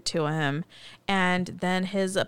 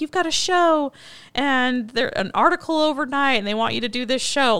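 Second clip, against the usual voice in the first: higher, imitating someone bursting with news; retold excitement, raised pitch; excited announcement; 5 semitones higher, 5 dB louder than usual. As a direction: break big news with mounting eagerness. Acts out breaking big news.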